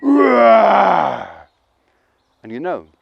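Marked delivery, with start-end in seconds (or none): 0.0-1.3 loud mock lion roar; long loud roar. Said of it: "And he roared".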